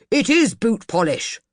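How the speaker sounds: retorting. Acted. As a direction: hit back at his wife's remark. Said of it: "retorted Mister Banks".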